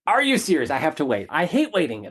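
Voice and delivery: high, incredulous complaint; mock outrage at having to wait; exasperated. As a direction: protest like a sulky child being told to wait. Exasperated retold reply to dream.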